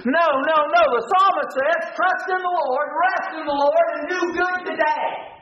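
high-pitched, urgent exhortation; impassioned preaching. Real, not acted. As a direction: preach it high, correcting the congregation. Preacher rebutting the excuses.

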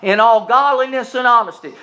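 high, loud reading; preached scripture. Acted. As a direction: lift the verse over the congregation.

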